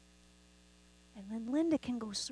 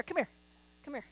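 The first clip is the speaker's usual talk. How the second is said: ordinary delivery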